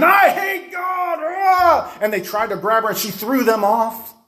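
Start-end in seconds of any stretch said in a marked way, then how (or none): 0.0-1.2 high, slow, growled shout; demonic growl. Retold or imitated. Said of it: "She started growling at me and saying". 1.2-2.0 high piercing possessed scream; demonic shriek. Retold or imitated.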